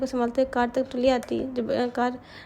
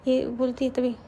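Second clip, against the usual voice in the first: plain reading voice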